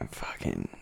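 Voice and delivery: breathy whispered aside. Real, not acted. Friend whispers a swear word mid-story.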